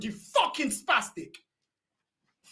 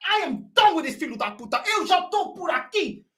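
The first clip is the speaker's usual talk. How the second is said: ordinary talk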